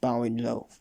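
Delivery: ordinary delivery, nothing marked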